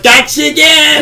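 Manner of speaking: high, loud, slow taunt; gloating shout. Retold or imitated. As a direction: crow over the kill.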